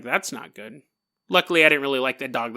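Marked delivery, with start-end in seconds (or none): 0.0-0.8 low, hushed worry; understated dread. Acted. Storyteller as the hermit seeing only two dogs return, understated dread. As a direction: say it small, under your voice.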